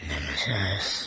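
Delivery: ominous deep voice. Pretend. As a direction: intone it like a movie trailer. Echoes ride name dramatically.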